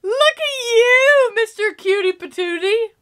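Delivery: tearful. Real, not acted. Tearful, moved by Peter's costume.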